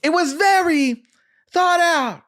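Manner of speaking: high-pitched, overdone captain delivery; Kerry Washington impression. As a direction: declaim it high and measured like an army captain. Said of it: "this is how captains speak".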